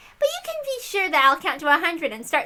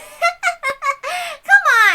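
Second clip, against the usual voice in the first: high mocking laugh; 9 semitones higher, 5 dB louder than usual. Witch's laugh.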